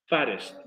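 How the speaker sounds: "Fattest" with the middle T tapped like a Spanish R; "Fattest" with a soft tap for the double T; American flapped T. Shown as a demonstration. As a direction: say "Fattest" American-style, T as a quick R. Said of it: "como si fuera una R".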